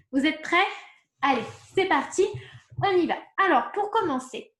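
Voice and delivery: excited rallying. Real, not acted. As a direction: cheer the learners into the next part. Teacher rallying class to start.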